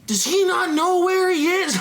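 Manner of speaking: high, laughing mock incredulity; mock disbelief. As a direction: imitate a baffled onlooker, cracking up. Acting out onlookers' judgement, laughing.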